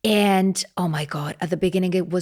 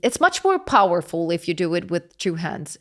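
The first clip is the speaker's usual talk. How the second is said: ordinary talk, nothing marked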